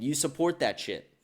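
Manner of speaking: usual speaking voice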